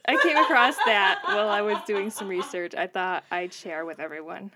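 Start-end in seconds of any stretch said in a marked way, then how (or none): 0.1-2.7 laughing. Real, not acted. Laughing after own pun.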